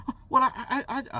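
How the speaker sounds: high mock sputtering, getting nowhere; flustered stammer. Lady stammers at his refusal.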